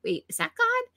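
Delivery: startled surprise. Retold or imitated. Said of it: "And I was like".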